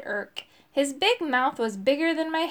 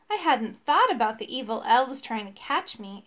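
ordinary delivery

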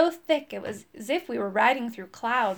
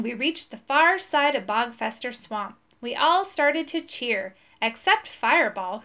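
ordinary delivery

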